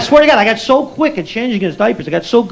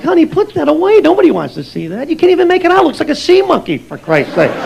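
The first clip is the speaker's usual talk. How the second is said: higher, exasperated brush-off; exasperated dismissal; 7 semitones higher than usual. Retold or imitated. Comic telling someone to put the ultrasound away, exasperated dismissal. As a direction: wave it away, mock-exasperated.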